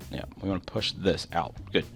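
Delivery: ordinary talk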